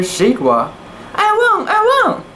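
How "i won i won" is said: high, squealing victory cry; excited cry. A narrator's reading. Big sister crowing at her brother, high and excited. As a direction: crow about winning in a high voice. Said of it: "cried Sedwa".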